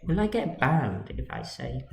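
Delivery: ordinary talk, nothing marked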